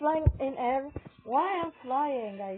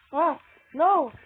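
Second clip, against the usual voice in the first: loud, sharp cry of "no"; shout of dismay; 4 semitones higher, 10 dB louder than usual. Real, not acted. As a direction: yell it out as you lose. Gamer losing a round.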